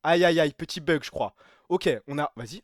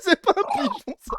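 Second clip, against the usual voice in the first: laughing. Real, not acted. Still laughing.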